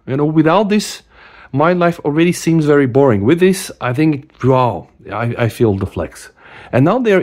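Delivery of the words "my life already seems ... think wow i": usual speaking voice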